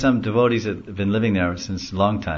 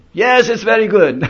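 high, loud yes through laughter; laughing crowd reply; 14 semitones higher, 8 dB louder than usual. Retold or imitated. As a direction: answer while laughing.

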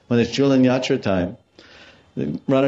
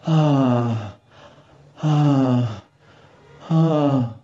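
long, high, falling sighs; demonstrated sigh; 5 semitones higher, 3 dB louder, much slower than usual. Shown as a demonstration. Teacher shows how the gopis were sighing, heavy demonstrative sighs. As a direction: heave three drawn-out "ahh" sighs, each falling away. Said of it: "This is sighing".

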